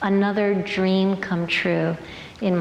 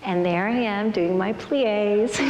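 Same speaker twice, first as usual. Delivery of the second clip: usual speaking voice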